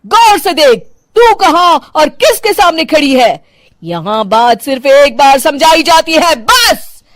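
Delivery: strict mother-in-law losing patience with her daughter-in-law; irritated mother-in-law. Acted. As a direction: snap at the disobedient bride. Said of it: "झल्ला उठती है".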